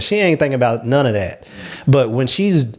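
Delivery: ordinary delivery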